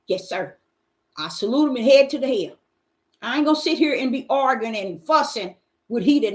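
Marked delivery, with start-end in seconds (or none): none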